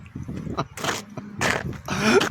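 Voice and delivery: breathless stifled laugh; airy giggle. Real, not acted. Remembering his mate on the slope, an airy giggle. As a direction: giggle helplessly at the memory.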